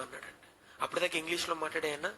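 ordinary talk, nothing marked

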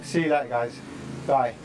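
ordinary talk, nothing marked

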